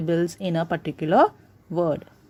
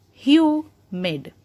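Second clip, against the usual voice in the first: "humid" with a high, drawn hu, quick mid; stress on first syllable; 5 semitones higher than usual. Shown as a demonstration. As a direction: punch hu in "humid", finish quickly. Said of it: "hue is being stressed a lot".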